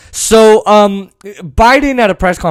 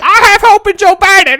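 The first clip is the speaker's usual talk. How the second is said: much higher shouted mock voice; mock naive progressive; 7 dB louder than usual. Parodying a hopeful progressive, shrill shout. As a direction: squeal it like a gullible fan. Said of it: "what fucking progressive said".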